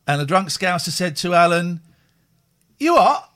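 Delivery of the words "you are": put-on Scouse accent. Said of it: "in Scouse accent".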